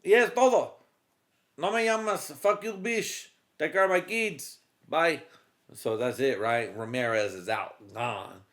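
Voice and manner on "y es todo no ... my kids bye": high, loud Spanish-tinged goodbye; Mexican husband. Retold or imitated. Ramirez saying bye to wife, high and loud. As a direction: toss it off like a Mexican dad leaving. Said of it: "actually for him, he's like".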